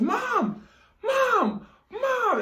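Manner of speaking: high yelled calls; calling out. Retold or imitated. Kid calling mom again.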